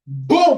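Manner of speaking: sudden loud high "Boom!" like a blow landing; mock sound effect of a receiver striking a head. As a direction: punch out the impact, high and loud. Sound effect of phone receiver hit.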